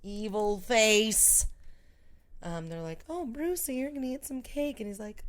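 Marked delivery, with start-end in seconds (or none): 3.1-4.8 higher sing-song coaxing; lightly voiced teasing grown-up; mock-sweet taunt. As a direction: coo it at a small boy. Voices the cake-offering taunters.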